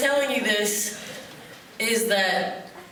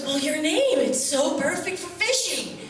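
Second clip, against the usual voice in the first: higher, slow admiring drawl; admiring fisherman; 6 semitones higher, slower than usual. Retold or imitated. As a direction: savour the name like it's a catch. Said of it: "he goes".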